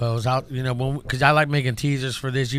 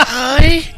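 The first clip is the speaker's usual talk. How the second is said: high, loud mock moan; 12 semitones higher, 11 dB louder than usual. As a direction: moan like getting hosed. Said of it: "You're like".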